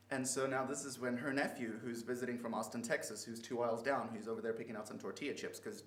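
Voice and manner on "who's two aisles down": normal talking voice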